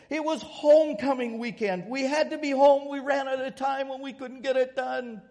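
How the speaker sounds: high, loud, whiny excuses; begging students. Retold or imitated. Students begging for a second extension, high whiny voice. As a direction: pile on excuses like begging kids. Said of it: "they begged".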